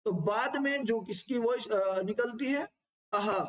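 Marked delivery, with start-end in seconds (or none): 3.1-3.4 आहा as a voiceless breathy h release; visarga breath sound. Shown as a demonstration. Teacher demonstrating the visarga breath to students. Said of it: "तो आहा निकलता है कैसा".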